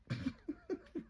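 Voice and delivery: high breathy little laugh; a quick amused giggle; airy giggle. Real, not acted. Laughs about tiny stuff.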